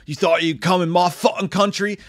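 a hint of a loud angry local; xenophobic rant. Killer to the tourist, mildly loud angry rant. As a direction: bark it at the tourist. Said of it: "his whole thing is".

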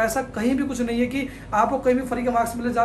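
ordinary delivery

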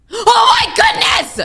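high, drawn-out shriek of shock; real jump-scare yell; startled shout. Real, not acted. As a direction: scream out in sudden fright.

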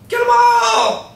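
high, slow battle shout. Acted. Gang boss orders attack.

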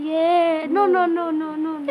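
loud frightened no no no; panicked denial, near crying. Real, not acted. Scared by the answer.

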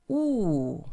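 Wu falling sharply; fourth tone wu. Shown as a demonstration. Teacher models the falling fourth tone. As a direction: cut Wu down hard from high. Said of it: "is going down".

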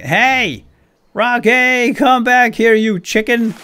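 high, loud yell after a runaway pet; calling out. Real, not acted. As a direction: shout across the field to bring him back.

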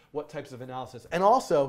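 normal talking voice

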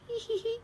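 high, soft giggle; teasing titter. A narrator's reading. Peppa giggles at silly Daddy.

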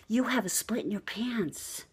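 hushed, discreet whisper; a secret whispered to a stranger. Retold or imitated. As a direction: whisper it so nobody else hears. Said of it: "She's probably saying".

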